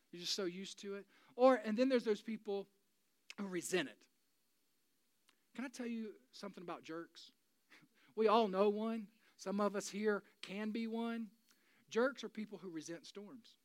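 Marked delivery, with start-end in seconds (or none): none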